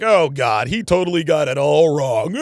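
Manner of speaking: loud, exasperated mock groaning; mock dismay. Streamer imitates Destiny reacting to the vows, mock dismay. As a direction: act out exaggerated secondhand embarrassment.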